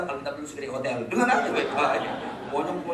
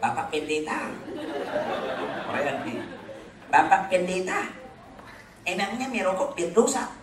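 put-on Korean accent; 2 semitones higher than usual. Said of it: "dengan logat Koreanya".

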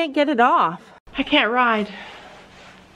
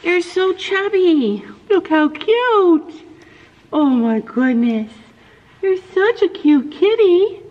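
pet talk; 5 semitones higher, 3 dB louder, slower than usual. Real, not acted. Woman dotes on the chubby kitty in baby talk. Cooing at the cat.

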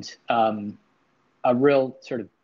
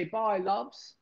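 high, soft British-accented "Bye, loves"; a quick demo of the British goodbye, lifted high; 8 semitones higher, 7 dB quieter than usual. Shown as a demonstration. Showing chat how to say goodbye in a British accent. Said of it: "with a British accent".

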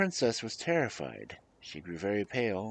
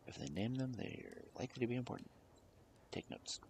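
hushed, confidential whisper; whispered aside; 2 semitones higher, 14 dB quieter, faster than usual. Real, not acted. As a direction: whisper a quick tip to the listeners. Whispered aside to listeners during reading.

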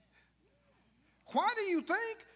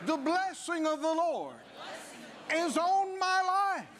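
building proclamation; 3 semitones higher than usual. Real, not acted. Preacher leading a confession of blessing, building to a shout.

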